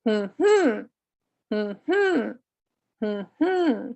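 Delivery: short hum, then longer rising-falling hum; unstressed-stressed hum. Shown as a demonstration. Teacher showing third column, hum stressed on second beat. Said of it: "starts with an unstressed syllable".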